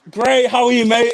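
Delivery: loud, high hello; cheery greeting. Real, not acted. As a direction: call out a warm hello. Greeting caller loudly.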